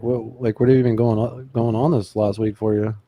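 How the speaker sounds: normal talking voice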